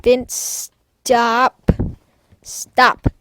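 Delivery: loud, sharp calls to a pet; real exasperated yell at the cat. Real, not acted. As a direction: stop the cat, loud and firm. Scolding her cat again.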